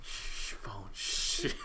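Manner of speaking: hushed whisper. Real, not acted. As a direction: shush it in a whisper. Hushing the ringing phone.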